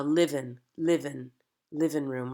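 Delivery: living said as livin', ending on a plain N; dropped G ending. Shown as a demonstration. Coach demonstrating the accent's endings to actors, dropped G. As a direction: say livin' with no G at the end. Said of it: "We're gonna drop N G endings".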